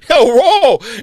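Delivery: high, loud hammed-up whoa; mock thrilled exclamation; mock excited. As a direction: gush over the free food sarcastically. Mock excitement at free food.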